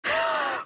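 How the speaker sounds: big, high intake of breath; sharp gasp. Real, not acted. Host about to start the awards, big gasp. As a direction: suck in air audibly before starting.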